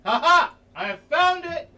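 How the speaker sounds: high, loud villainous triumph; mock triumph. Streamer gloating to chat over the found headrest, high.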